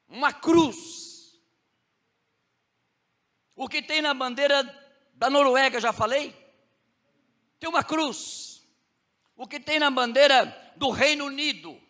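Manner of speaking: pregação crescente, mais alta, lenta nas respostas; preaching. Acted. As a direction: martele cada resposta para a igreja. Preacher climaxes on flags. (pregação em crescendo, bem mais alta)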